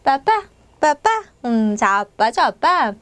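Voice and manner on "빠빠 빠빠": 빠빠 with two hard unaspirated p sounds; tense p. Shown as a demonstration. Teacher modelling the word for dad, 빠빠 with tense p. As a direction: say 빠빠 with tense double p, each syllable distinct. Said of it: "된소리 발음으로".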